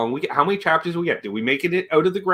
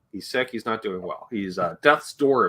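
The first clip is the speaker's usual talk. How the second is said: normal talking voice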